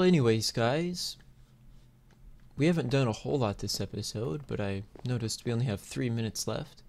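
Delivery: ordinary talk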